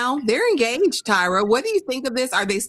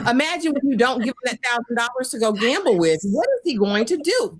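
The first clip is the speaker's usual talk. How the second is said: ordinary talk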